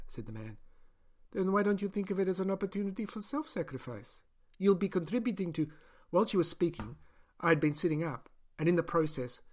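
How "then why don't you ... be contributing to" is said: lightly raised, earnest scientist; a doctor justifying her experiment; higher-pitched persuasive doctor; doctor's voice. Acted. Doctor argues with Gaian.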